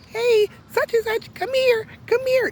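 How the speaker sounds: high-pitched coaxing call to a pet; dog-calling voice. Retold or imitated. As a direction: imitate her sweet high voice for the dog. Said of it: "changing her voice to talk to a dog".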